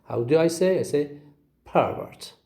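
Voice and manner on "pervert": "pervert" as PER-vert, falling on the second syllable; stress on first syllable. Shown as a demonstration. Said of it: "have the first syllable as the stressed".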